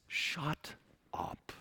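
a hushed, unvoiced shut up; whispered rebuke. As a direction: hiss it under your breath. Jesus rebuking the critics.

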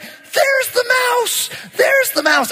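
1 panicked cry. Retold or imitated. Wife spots mouse in garage.

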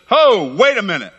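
loud, high-pitched "hold on"; Jesus calling a halt. Acted. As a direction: call out to stop everyone in their tracks. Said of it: "Jesus enters that temple".

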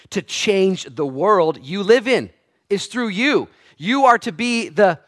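loud, high, driving sermon voice; preaching at full force. Acted. Preacher rousing the congregation to act, full-force preaching.

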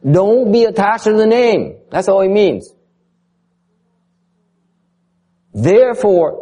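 fervent lecturer pitch; emphatic teaching. Real, not acted. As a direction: drive the point home to the students. Teacher stressing key point.

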